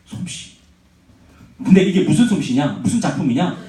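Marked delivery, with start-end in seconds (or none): 0.1-0.5 솜씨 said in a whisper; whispered repeat. Shown as a demonstration. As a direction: hush 솜씨 almost silent. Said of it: "솜씨예요".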